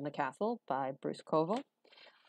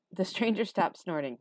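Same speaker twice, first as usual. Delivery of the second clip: ordinary delivery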